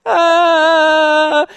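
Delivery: put-on loud, high held baby wail; mock cry, one long high note; imitated child's crying. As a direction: mimic a toddler wailing in the background. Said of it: "crying in the background".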